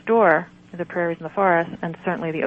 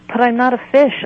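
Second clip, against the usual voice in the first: higher and louder, a wailing protest; 6 semitones higher, 7 dB louder than usual. Acted. Patient protesting to the healer, a loud wail. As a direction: wail it out in despair. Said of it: "I wailed".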